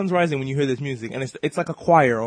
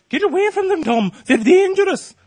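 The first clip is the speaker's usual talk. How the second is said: shrill high mock-mom shriek, much louder; panicked mom; 10 dB louder than usual. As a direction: shriek a warning at your kid, hammed up. Jerk mom shrieking at kid.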